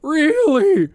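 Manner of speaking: squeaking Really? way up high; mock voice crack. Shown as a demonstration. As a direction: redo the crack on purpose, hammed up. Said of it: "my Dutch voice crack".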